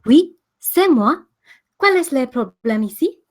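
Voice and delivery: put-on French aristocrat indignation; indignant French aristocrat. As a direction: play the offended French noble. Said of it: "perfect as an indignant member of the French aristocracy".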